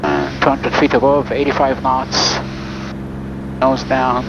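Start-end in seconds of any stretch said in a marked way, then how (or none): none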